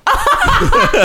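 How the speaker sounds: very high, loud laughter; real helpless shriek of laughter. Real, not acted. Laughing at the impression.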